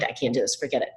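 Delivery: low, dismissive mock resignation; mind made up, giving up. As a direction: imitate someone quietly giving up. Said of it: "The brain has made up the mind".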